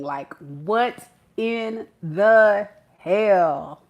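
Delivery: slow disbelief. Acted. Person getting no reaction from Scorpio, slowly spelt-out disbelief. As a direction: stretch each word, dragging out the last phrase. Voices the person's baffled failure.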